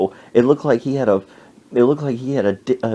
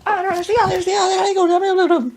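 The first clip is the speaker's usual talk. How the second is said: high squeaky rattled-off goodbye; motor-mouth neighbor chattering away; super-fast talker; much faster than usual. Retold or imitated. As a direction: babble it in a very high voice. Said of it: "This woman talks super fast".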